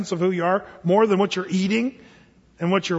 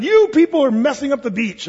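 retold accusation; 7 semitones higher, 5 dB louder than usual. Retold confrontation on the beach.

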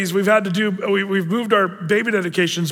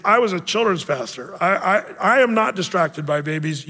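normal talking voice

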